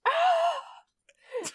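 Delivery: high-pitched pained yelp; death cry. Retold or imitated. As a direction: yelp like a punched villain.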